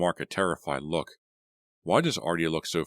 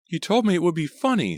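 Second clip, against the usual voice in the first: high, loud upset reproach; high distressed girl's cry; 13 semitones higher, 6 dB louder than usual. A narrator's reading. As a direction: cry out in dismay. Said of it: "Sandy cried".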